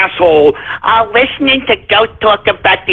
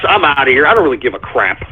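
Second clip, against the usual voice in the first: ordinary talk, nothing marked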